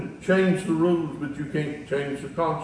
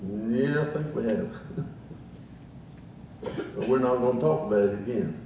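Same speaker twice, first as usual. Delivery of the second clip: low, softened retold reply; subdued imitation of a father-son talk; hushed, lower voice for a remembered reply; lightly acted exchange; 5 semitones lower, 6 dB quieter, faster than usual.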